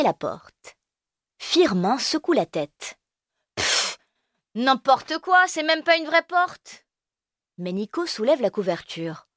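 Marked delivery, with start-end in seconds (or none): 3.5-6.9 put-on high scornful kid; scoffing. Firmin scoffing at Elio's blanket door. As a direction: dismiss it with contempt.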